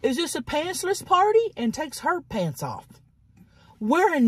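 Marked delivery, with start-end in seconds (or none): 0.0-1.5 high, airy mock question; mocking high girly voice. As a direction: voice her like a ditzy partygoer. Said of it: "she goes".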